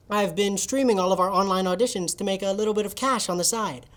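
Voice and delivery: ordinary talk, nothing marked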